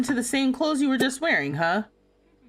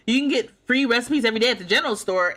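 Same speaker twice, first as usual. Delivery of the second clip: ordinary talk, nothing marked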